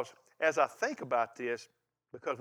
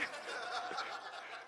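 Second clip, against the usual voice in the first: laugh; 8 semitones higher, 15 dB quieter than usual. Real, not acted. Preacher laughing at his own joke.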